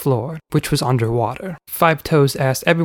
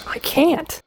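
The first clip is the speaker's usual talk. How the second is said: put-on boy voice. A narrator's reading. Boy replies.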